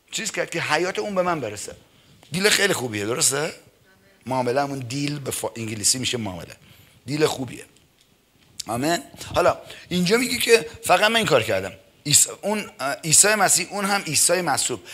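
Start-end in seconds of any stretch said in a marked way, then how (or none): none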